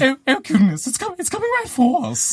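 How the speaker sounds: high panicked yelp. Acted. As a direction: squeal in sudden terror. Monster charges at them.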